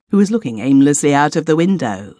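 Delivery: ordinary delivery, nothing marked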